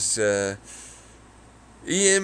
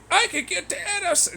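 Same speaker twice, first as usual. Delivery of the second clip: much higher, goofy mock quote; high silly voice. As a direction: squeak the quote. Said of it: "I was like".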